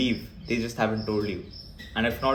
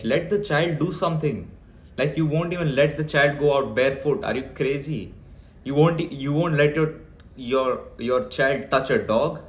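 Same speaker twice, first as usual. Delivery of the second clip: usual speaking voice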